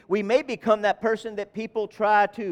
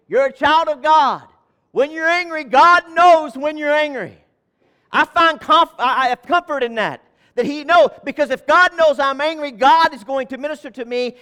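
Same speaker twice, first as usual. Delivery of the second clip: louder, higher preacher's swell; preaching at full force; 7 semitones higher, 8 dB louder than usual. Acted. Pastor telling believers God knows their anger, preaching loud. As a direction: lift the room.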